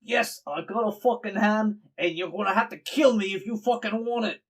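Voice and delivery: loud, high, furious challenge; angry defiant shout. Acted. Game master as cornered woman to hand-seeker, angry shout. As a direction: yell it as a threat to fight.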